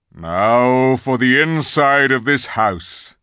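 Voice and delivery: slow, loud call to the troops; commanding lion. A narrator's reading. Aslan sends everyone to search the castle, commanding. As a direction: ring it out slowly as a great leader. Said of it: "said Aslan".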